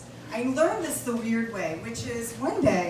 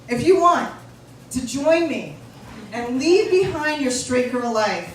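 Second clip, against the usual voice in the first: usual speaking voice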